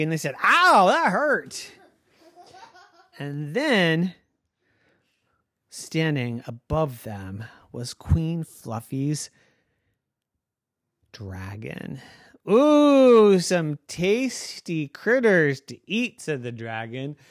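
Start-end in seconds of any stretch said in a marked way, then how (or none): 0.4-1.7 high, slow comic ouch; mock pain. Knights crash into tree. 12.5-16.2 greedy dragon. Acted. Dragon voiced in bedtime story.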